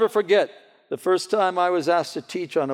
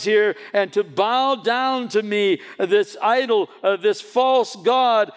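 a hint of a bossy king, higher and louder; lightly voiced tyrant king giving a last chance; threatening king; 8 semitones higher, 5 dB louder than usual. King threatening the three youths.

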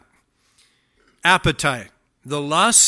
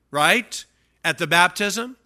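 usual speaking voice

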